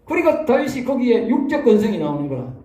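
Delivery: ordinary talk, nothing marked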